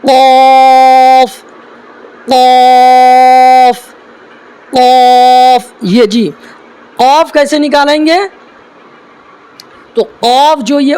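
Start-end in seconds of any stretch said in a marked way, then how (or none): 0.0-5.7 slow, deliberate قاف; drawn-out letter demo. Shown as a demonstration. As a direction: stretch each قاف slowly. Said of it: "اگلا حرف ہے".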